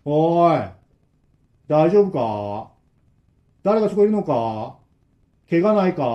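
lightly voiced old man, slow calls; old man calling. Old man behind the door asks if they are hurt, slowly. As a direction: call through the door, unhurried. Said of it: "向こうのおじさんは".

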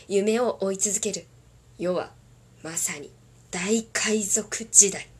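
loud, dramatic anime opening narration; 壮大なアニメ冒頭ナレーション; One Piece opening narration. Acted. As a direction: declaim it like the epic anime intro. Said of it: "ワンピースの".

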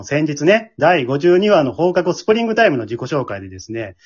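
usual speaking voice

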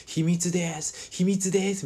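high, drawn-out singsong tease; slow, high-pitched mock-playful voice; teasing woman. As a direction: imitate a coy caller keeping a secret. Woman teasing man on phone, retold.

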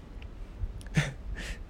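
quiet unvoiced chuckle; soft breathy laugh. Real, not acted. Laugh alone on line.

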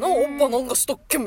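rough, fast grandpa dialect imitation, laughing; gruff old man Kumamoto dialect. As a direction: mimic a grumpy grandpa in thick dialect. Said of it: "まあ、ちょっと怒ってる。". (おじいちゃんのごつい熊本弁の真似)

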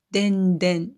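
でんでん said den-den, d for z; Wakayama pronunciation. Shown as a demonstration. Speaker showing how Wakayama folk say 全然, でんでん with d. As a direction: say でんでん with d in both syllables. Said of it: "全然は".

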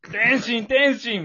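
a put-on sobbing shout; mock tearful calling. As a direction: wail the name like a heartbroken fan. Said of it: "みたいな".

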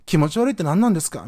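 imitating indignant women; short-tempered women. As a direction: retort loudly like someone quick to take offence. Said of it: "女性二人もまあまあ沸点低めで".

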